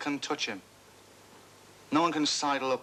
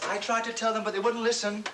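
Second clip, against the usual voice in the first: mocking everyone; 9 semitones higher than usual. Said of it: "everyone's going round saying".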